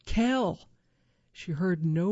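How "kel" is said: louder, higher call of the name; hollered call. A narrator's reading. Wife calls for her husband after a landslide, restrained holler. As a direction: holler for someone out of sight. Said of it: "she hollered".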